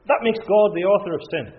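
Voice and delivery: mock outrage of the critics; indignant objection. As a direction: throw out the objection with scorn. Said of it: "They'd say".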